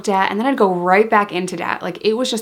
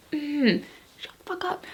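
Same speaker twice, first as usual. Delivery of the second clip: high-pitched, barely audible hiss; hushed warning; 7 semitones higher, 9 dB quieter than usual. Retold or imitated. Telling the ex that brother is outside the door, hushed warning. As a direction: shush them under your breath, urgently.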